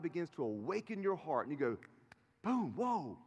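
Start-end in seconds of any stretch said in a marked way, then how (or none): 2.4-3.2 higher startled exclamation; a burst of surprise; sudden realization. Pretend. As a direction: gasp it like it just hit you. Heart awakening realization.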